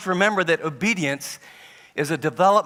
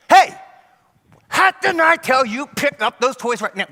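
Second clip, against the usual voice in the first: higher, louder angry scold; scolding yell; 10 semitones higher, 8 dB louder than usual. Retold or imitated. Embarrassed parent scolding kids in front of friends, angry yell. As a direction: snap at the kids.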